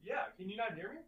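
faint and distant, off mic; far from mic. Real, not acted. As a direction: voice far away from the microphone. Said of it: "You sound very distant".

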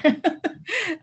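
a quick amused laugh; light chuckle; short laugh. Real, not acted. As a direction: laugh at your own joke. Joking about the one true ward.

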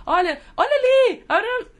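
high, loud, slow "look over there"; sing-song mom coaxing her kid; mother distracting child. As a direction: point out something exciting to a toddler. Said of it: "Começou a conversar".

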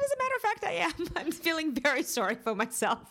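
high, whiny mock self-pity; self-pitying retort. Answering the scolding friend, high and whiny. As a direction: own the self-pity with a pouty high whine. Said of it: "I said".

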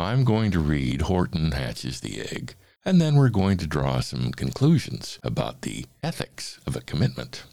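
usual speaking voice

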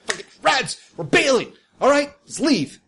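quick, high call to his gang; high boss voice. Acted. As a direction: order the retreat. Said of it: "He's like".

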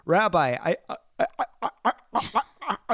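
mock the stuck stutter, pitch rising; imitating a stutterer. Sam Schulman stuck on a word to the rabbi, stammering high. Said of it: "he had one of those things where he couldn't get the word out".

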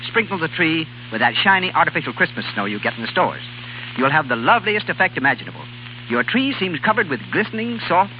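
normal talking voice

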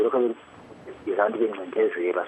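usual speaking voice

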